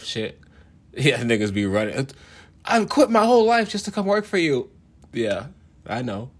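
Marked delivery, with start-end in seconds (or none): 2.6-4.7 higher, louder gushing follower voice; mock devoted follower. A follower begging to join him, mock gushing. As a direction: gush like a starstruck recruit.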